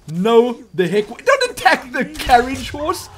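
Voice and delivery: loud, high, drawn-out protest; protesting yell. Real, not acted. Player yelling at vampires attacking the horse, outraged.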